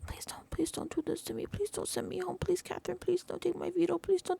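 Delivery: high pleading, hushed then building; mock pity party. Housemate begging Catherine to spare his veto, mock pity party. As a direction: mimic pitiful begging, rising out of a whisper. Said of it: "doing the little pity party".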